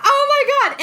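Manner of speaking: high, loud alarmed cry; panicked gasp. Retold or imitated. Mother panicking over her baby, high alarmed cry. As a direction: blurt it out in fright.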